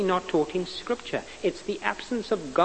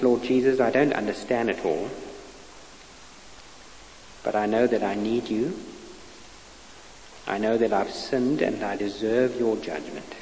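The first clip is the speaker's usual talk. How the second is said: prayer; 4 semitones lower than usual. Acted. Preacher leads the listeners in a prayer of repentance. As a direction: lead them gently through the prayer.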